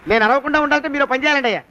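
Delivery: ordinary talk, nothing marked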